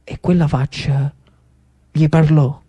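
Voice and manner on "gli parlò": ordinary delivery, nothing marked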